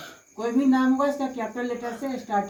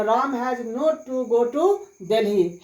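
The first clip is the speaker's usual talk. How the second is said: very slow, each word spelled out for students; slow dictation; much slower than usual. Shown as a demonstration. Teacher dictating the English answer to students, very slowly. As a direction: say it slowly so students can write. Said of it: "teacher dictates answer".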